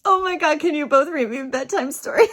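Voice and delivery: high, loud, fast excited begging; thrilled retold plea, tumbling out quickly; gushing plea. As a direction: burst out in starstruck delight. Retold gushing request to couple.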